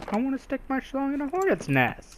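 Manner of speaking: mock eager. Real, not acted. Player mocks game line eagerly.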